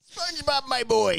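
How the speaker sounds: high cartoon crab voice; Mister Krabs voice. Friends swap SpongeBob impressions, crab greeting SpongeBob. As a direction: greet the boy like a proud boss. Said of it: "We should do SpongeBob impressions".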